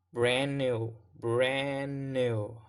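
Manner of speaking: "brand new" slow and joined on a long N; linked without D. Shown as a demonstration. Teacher repeats the linked phrase, "brand new" without a D. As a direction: stretch "brand new", leaving out the D. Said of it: "The two words link together".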